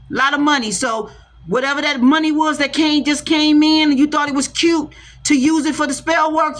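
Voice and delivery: raising the voice against whoever threw spell work; fired-up warning. Real, not acted. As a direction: warn them off at full heat. Heated warning to spell casters.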